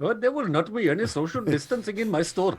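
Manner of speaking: playful imitation of an Indian accent. Co-host joins in as store owner, Indian accent. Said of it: "unless Indian".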